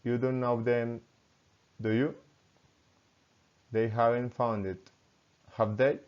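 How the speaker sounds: level statement, then a rising tag; tag question intonation. Shown as a demonstration. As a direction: lift "do you", "have they", "were you", "should I". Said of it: "falling or rising intonation".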